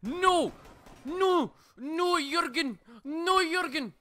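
high-pitched panicked shouts; frantic yelling. Real, not acted. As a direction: scream at the teammates to stop. Yelling at teammates in game.

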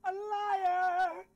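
high, loud, slow mock accusation; hammed-up accusation. Friend jokingly accusing the other of lying, loud and high. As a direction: bellow out the word liar, slowly, over the top.

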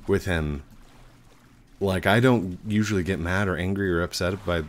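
ordinary delivery

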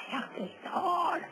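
slow, high wailing cry; wailing shout. Acted. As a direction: wail the name out slowly. Guest wails the name. (grito lastimero)

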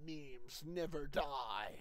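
slow, hushed otherworldly voice; booming mystical voice. Acted. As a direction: intone it like a distant spirit. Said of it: "A voice rings out saying".

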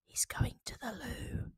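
hushed conspiratorial whisper; whispered aside. Acted. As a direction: lean in and whisper the secret. Narrator's sly aside.

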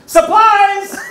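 put-on high, loud burst in a caricatured Japanese accent; hammed-up Japanese-accented surprise yell; mock Japanese accent. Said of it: "making fun of the way they talk".

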